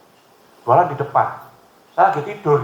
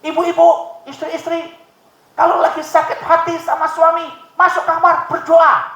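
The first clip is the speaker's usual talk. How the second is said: preacher quoted; 12 semitones higher, 5 dB louder, slower than usual. Retold or imitated. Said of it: "Ada seorang pendeta bilang gini".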